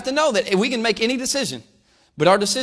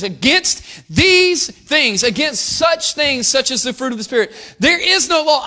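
louder, higher, slow declaration; forceful preaching; 6 semitones higher, 5 dB louder, slower than usual. Acted. Preacher proclaiming scripture to the congregation, forceful. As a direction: proclaim the verse with weight.

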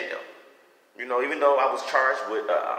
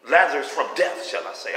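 ordinary talk

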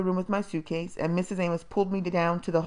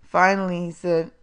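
usual speaking voice

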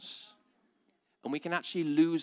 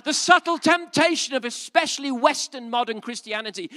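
full-force preaching; 12 semitones higher, 13 dB louder than usual. Acted. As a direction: build to a roaring pulpit climax. Preaching build to climax.

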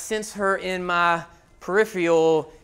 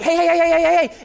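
loud, much higher repeated hey; frantic calling; 8 semitones higher, 6 dB louder, faster than usual. Retold or imitated. Father shouting onto the field, loud high calls. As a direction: shout to break it up. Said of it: "I'm like".

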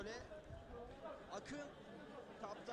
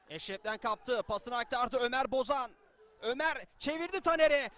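rising commentator excitement; 9 dB louder than usual. Real, not acted. Attack builds toward goal.

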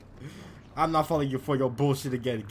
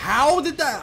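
higher, louder startled shout; sharp disbelief; shocked outcry; 12 semitones higher, 8 dB louder than usual. Real, not acted. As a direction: exclaim at the surprise. Sudden game surprise.

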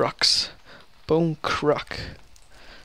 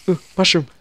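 higher, louder delighted find; thrilled at the discovery; excited; 6 semitones higher, 11 dB louder, faster than usual. Real, not acted. Finds a mushroom while playing.

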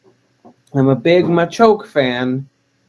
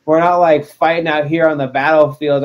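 ordinary delivery

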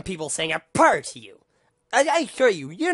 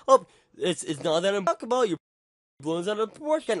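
mocking imitation; 3 semitones higher than usual. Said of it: "before anybody at all just go and saying".

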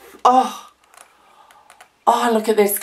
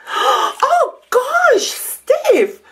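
very high gasp, then a squeal of her friend's name; squealing delight; much slower than usual. Real, not acted. Finding Steph's snow globe, squealing with delight. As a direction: squeal with real, overwhelmed joy.

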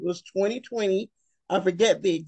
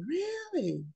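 high, quiet incredulous "Really?"; soft stunned disbelief; inner disbelief; 5 semitones higher, 7 dB quieter, slower than usual. Retold or imitated. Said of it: "And I'm thinking".

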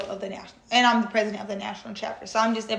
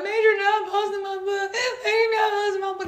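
high-pitched mock wail, getting faster; hammed-up sobbing disbelief; imitated crying; 10 semitones higher than usual. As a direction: re-enact blurting news through tears. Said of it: "I'm crying".

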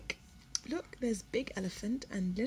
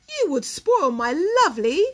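louder, snooty complaint; scornful; 4 semitones higher, 11 dB louder than usual. Acted. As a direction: fuss over your fine feathers. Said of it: "said the peacock scornfully".

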